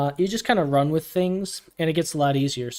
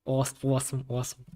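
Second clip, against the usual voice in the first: low, tentative catchphrase impression; lightly imitated, repeated under your voice; Sim; 3 semitones lower, 6 dB quieter, faster than usual. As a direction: try out someone's signature word, trailing softer. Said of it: "I'm trying to imitate him".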